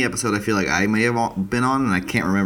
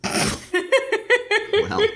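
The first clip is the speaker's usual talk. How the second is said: squeaky caught-out well; sheepish high well. Real, not acted. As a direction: pitch it way up, caught out.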